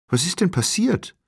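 high-pitched, alarmed question; startled woman. A narrator's reading. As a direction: pitch leaps up in fright. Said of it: "fragte die Frau erschrocken".

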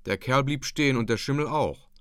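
ordinary delivery, nothing marked

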